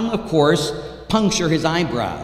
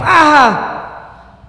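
high, loud cry of pain; hammed-up child's scream; 13 semitones higher, 11 dB louder than usual. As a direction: yelp as a bandage rips off. Kid's Band-Aid ripped off.

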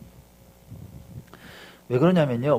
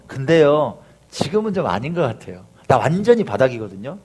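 doubting believer; 5 semitones lower, 6 dB quieter than usual. Acted. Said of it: "어떤 마음이냐, 이런 거예요".

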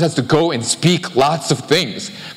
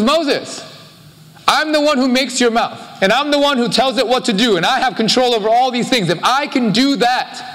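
higher, steady and commanding, a voice of authority; God speaking to Moses; 6 semitones higher than usual. Retold or imitated. God tells Moses he made his mouth, in a raised commanding voice. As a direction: speak as God reassuring a doubting servant. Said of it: "He says".

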